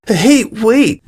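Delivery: sudden high call of alarm; crying out. A narrator's reading. Sweetie stops Diamond on seeing the mark, alarmed. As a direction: stop her with a sharp high cry. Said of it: "Sweetie cried".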